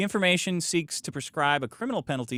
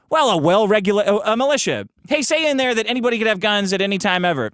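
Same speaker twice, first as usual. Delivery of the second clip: mock lawmakers drafting; 6 semitones higher, 5 dB louder than usual. Acts out drafters arguing over wording.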